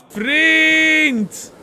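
calling out. Acted. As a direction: call for a missing friend, stretching the word. Calling for the lost friend.

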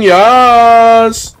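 put-on loud, high Indian accent. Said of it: "Totally not stereotyping".

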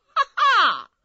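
loud, high ha-ha laugh; hearty laugh. Acted. Soldier laughs loud at the boast, proud and scornful.

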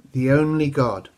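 ordinary delivery

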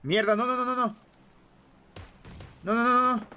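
frantic shouting. Real, not acted. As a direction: shout in panic at the screen. Under fire again.

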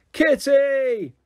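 high, long drawn-out shout; top of her voice. A narrator's reading. Lucy yelling for Kitty at the top of her voice. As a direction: yell it as loud as you can. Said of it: "at the top of my voice".

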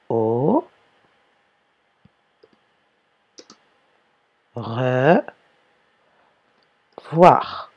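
"Au... re... voir" with long pauses between the parts; separated for dictation. Shown as a demonstration. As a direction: dictate "revoir" slowly, split as re, then voir. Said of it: "on ne colle pas".

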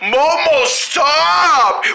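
high, stretched-out shout; calling after someone. Acted. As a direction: call them back. Calling after departing Momo.

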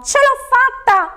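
very high, drawn-out cheer of triumph; exultant. A narrator's reading. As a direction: crow with joy, stretching the words. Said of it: "esclamò il ladro esultante". (grido acuto esultante)

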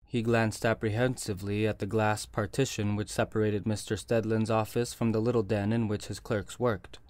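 usual speaking voice

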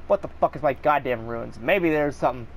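ordinary talk

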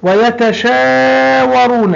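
ويتشاورون slow with its long aa and oo held; stretched reading. Shown as a demonstration. Teacher stretching ويتشاورون to show the long vowels. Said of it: "الحرف الممدود والألف مد".

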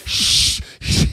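urgent hush, no voice; imitating a nervous friend hushing him; shushing whisper.

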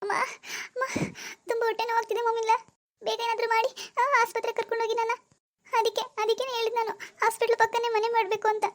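high, strained voice crying out in pain; moaning in pain, pleading with her mother-in-law. Acted. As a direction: beg for help through stomach cramps. Acted sudden stomach pain.

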